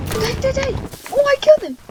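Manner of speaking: high, loud gamer yell; excited yelling. Real, not acted. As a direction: yell it mid-fight. Gamer yelling during kill.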